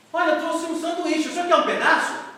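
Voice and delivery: put-on high, eager little boy voice; small boy's voice. A boy offering the old man his sandwich, high and eager. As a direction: play a shy kid offering food.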